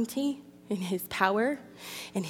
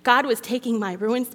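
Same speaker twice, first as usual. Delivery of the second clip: normal talking voice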